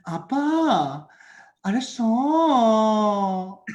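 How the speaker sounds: high, loud K-drama line; fake Korean K-drama. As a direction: act a daughter in a K-drama. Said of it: "my fake, uh, Korean, like, K-drama".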